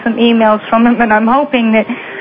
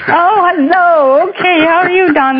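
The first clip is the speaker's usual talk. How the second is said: delighted greeting; 7 semitones higher than usual. Real, not acted. Host greets surprise caller on air.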